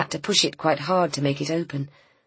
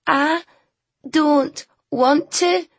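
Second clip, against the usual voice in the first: put-on loud, slow child shout; loud, from outside; 11 semitones higher, 5 dB louder, much slower than usual. A narrator's reading. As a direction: call it from another room. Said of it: "came a loud voice from outside".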